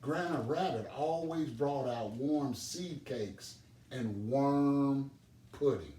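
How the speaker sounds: ordinary delivery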